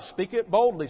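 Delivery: ordinary talk, nothing marked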